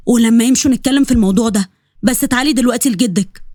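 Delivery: ordinary delivery, nothing marked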